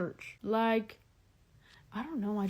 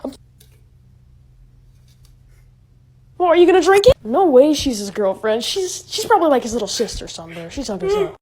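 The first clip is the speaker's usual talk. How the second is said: shouting at the screen; loud excited reacting; 4 semitones higher, 15 dB louder than usual. Real, not acted. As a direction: yell it out, thrilled. Reacting loudly to anime.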